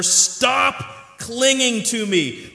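loud, slow and emphatic, drawn out; firm command. Acted. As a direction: declare it strongly, stretching each word. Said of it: "Jesus said to her".

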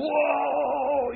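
high, drawn-out awed exclamation; startled reaction. Acted. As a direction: react as an astonished onlooker, without shouting. Acting out startled people's reaction.

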